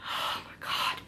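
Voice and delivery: breathy, whispered mock despair; exasperated sigh. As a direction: sigh it out under your breath, worn out. Exasperated reaction to slow reading.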